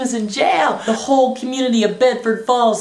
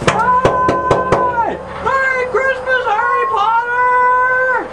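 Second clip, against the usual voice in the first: high holler with a long final word; crazed elation; 14 semitones higher, 3 dB louder, much slower than usual. Acted. George shouts to his arch enemy, crazed elation. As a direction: yell a joyful greeting across the street. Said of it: "runs across the street to his arch enemies".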